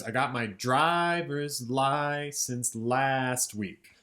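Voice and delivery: slow chant with first syllables hit; first syllables stressed. Shown as a demonstration. Teacher speaks the lyric slowly, hitting first syllables. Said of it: "emphasis on the first syllable".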